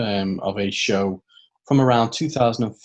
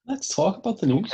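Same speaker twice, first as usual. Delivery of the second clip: high put-on announcer echo; mock news voice; 11 semitones higher than usual. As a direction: parrot it in a silly voice. Mocking echo in odd voice.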